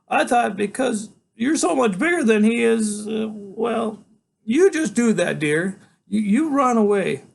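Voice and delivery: a doting grandmother begging her grandson; fussy grandmother. Acted. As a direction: coo it like a worried granny. Grandmother voiced again.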